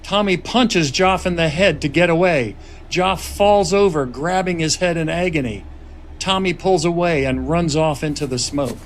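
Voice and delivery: measured screenplay narration; script narration. A narrator's reading. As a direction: read the scene directions steadily. Reads screenplay narration aloud.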